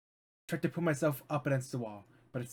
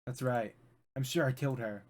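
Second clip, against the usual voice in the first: ordinary talk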